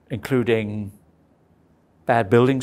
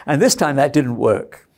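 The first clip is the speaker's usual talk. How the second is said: ordinary delivery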